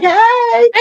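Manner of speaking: very high, singsong hello; high teasing "welcome back" voice; mock-cheery greeting. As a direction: greet them in a playful high squeal. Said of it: "I'll be like".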